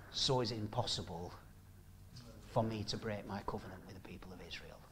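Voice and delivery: hushed, fast whisper; sermon whisper. Real, not acted. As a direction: drop to a whisper to close the point. Quiet hushed close of the point.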